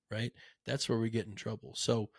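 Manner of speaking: ordinary delivery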